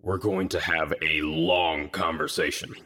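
very deep, soft threat; low, menacing. A narrator's reading. Leader warns teammate of a reckoning, low and menacing. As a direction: threaten quietly from the bottom of your range. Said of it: "his tone low and menacing".

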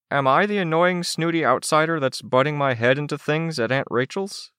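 put-on high, rising kid's question; dismayed Chicken Little. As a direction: ask it high, with dawning worry. Voices Chicken Little in the story.

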